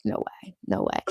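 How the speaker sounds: hushed, very low mock disbelief; retold disbelief. Streamer recalling finding out Celsius was clear, hushed disbelief. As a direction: drop to a barely voiced murmur. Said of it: "I was like".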